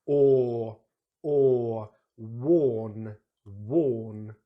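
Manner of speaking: or and worn with lips pushed out; rounded or. Shown as a demonstration. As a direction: push the lips out for or. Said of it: "really rounding the lips".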